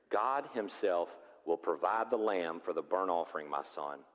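tender father. Acted. Abraham answers son tenderly.

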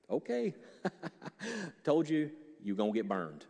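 usual speaking voice

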